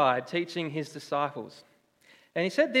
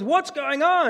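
higher, louder mock mum demanding answers; mum stepping in; 10 semitones higher, 6 dB louder, slower than usual. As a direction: play the mum bursting in on the fight. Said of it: "she looks at us and goes".

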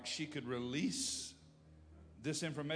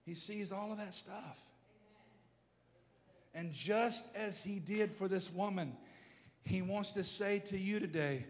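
ordinary delivery

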